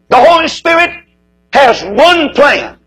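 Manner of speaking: The Holy Spirit cried out high and loud, has one plan slow; preaching climax. Real, not acted. Preacher proclaiming the Spirit's plan to his congregation, a high loud cry. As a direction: ring out Holy like a revival call.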